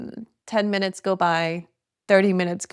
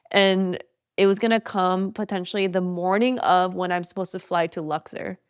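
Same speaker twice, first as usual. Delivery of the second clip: normal talking voice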